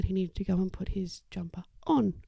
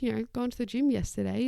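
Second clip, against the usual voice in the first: usual speaking voice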